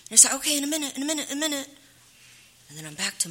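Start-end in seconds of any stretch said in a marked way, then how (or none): 0.3-1.7 high, repeated stalling; dismissive brush-off. Retold or imitated. Mother stalling her hungry son, high and dismissive. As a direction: put him off lightly and keep repeating.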